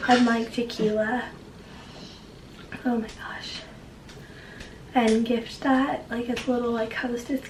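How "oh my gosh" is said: breathy whisper, voice gone; lost voice, whispered. Real, not acted. Voice giving out, whispering.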